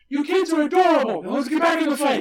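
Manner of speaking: high, loud, quick mock battle yell; imitated excited shout. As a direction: yell it like a mentor mid-fight. Acts out mentor yelling mid-fight.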